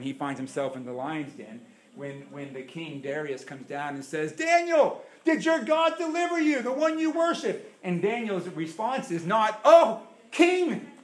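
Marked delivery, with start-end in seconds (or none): 4.3-7.7 king calling out. Acted. King calls Daniel. 9.6-10.9 high, loud call to the king; solemn address. Acted.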